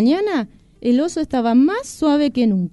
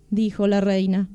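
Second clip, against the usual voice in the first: ordinary delivery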